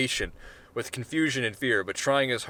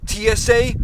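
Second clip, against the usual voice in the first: shrieking climax; 11 semitones higher, 10 dB louder than usual. Acted. Paranoid rant climaxes.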